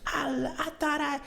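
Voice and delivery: put-on high, slow, slurring drunk ex; drunk ex impression. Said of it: "she definitely was fucking drunk".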